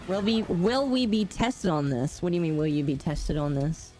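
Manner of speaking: ordinary talk, nothing marked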